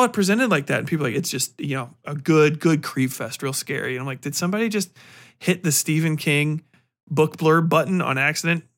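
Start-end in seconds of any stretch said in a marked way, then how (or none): none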